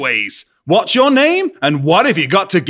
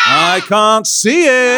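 hammed-up confusion; 3 semitones higher than usual. Panto back-and-forth.

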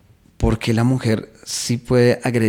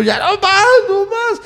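much higher, pleading; broken boyfriend. Acted. Boyfriend at breaking point, high pleading plea. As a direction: give up and beg her to stop. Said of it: "El tipo".

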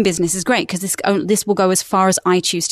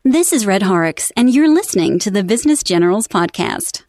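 American-accented podcast voiceover, a bit punchy. Shown as a demonstration. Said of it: "And then in American, it would be".

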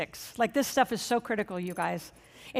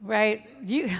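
ordinary talk

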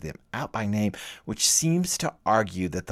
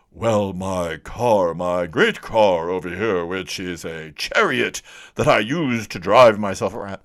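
a pompous professor talking about his car; lofty Harvard don at a Southern filling station; 5 dB louder than usual. Retold or imitated. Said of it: "some Harvard Don walked into".

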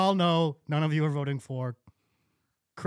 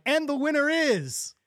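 higher, suspenseful host reveal; mock game show host; 8 semitones higher than usual. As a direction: tease the winner like a TV host. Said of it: "it's gotta be like".

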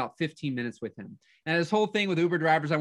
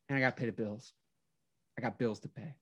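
imitated driver, low and soft; hushed confession; 6 semitones lower, 9 dB quieter, faster than usual. Driver to his passenger about bills, quietly confessing. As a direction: drop to a subdued, low admission.

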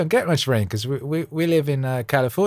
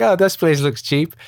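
imitating wife; 6 semitones higher, 5 dB louder than usual. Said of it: "She was like".

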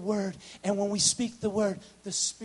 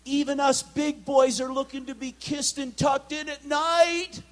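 full preaching; 9 semitones higher, 7 dB louder than usual. Real, not acted. Preacher tells the men they need comfort, loud preaching.

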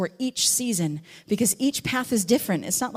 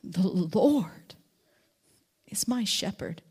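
whispered verse; slower than usual. Shown as a demonstration. Preacher whispers the verse in wonder at God's care. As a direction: whisper it in awe. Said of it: "You could say it".

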